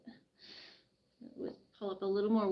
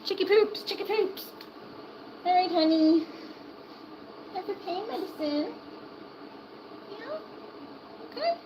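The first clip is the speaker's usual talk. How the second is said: sing-song pet talk; 9 semitones higher, 3 dB louder than usual. Real, not acted. Owner crooning to her chick before medicine, high sing-song. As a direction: croon it like a little ditty.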